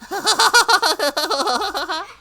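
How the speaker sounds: high, manic wailing laugh; long cackle that dies away; crying turning to laughter. Acted. Yoshimura's wailing changing into laughter, unhinged. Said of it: "crying somehow changes into laughter".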